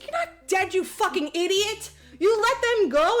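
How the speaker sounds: high, loud mock outrage; indignant scolding. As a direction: lay into him in mock disbelief. Acted scolding of Gump in story.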